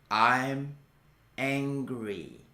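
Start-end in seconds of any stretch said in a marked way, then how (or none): none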